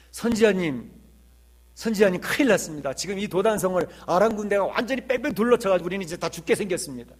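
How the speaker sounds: loud, high-pitched panicked report; alarmed servant. Retold or imitated. Servant wakes the prophet to report the surrounding army, alarmed. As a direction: cry out in alarm to your master.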